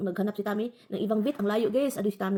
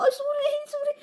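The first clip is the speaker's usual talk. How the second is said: very high, louder flustered sorry; startled apology; 5 dB louder than usual. Retold or imitated. Apologizing to the cat after pinching its tail, startled. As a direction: blurt sorry in shock.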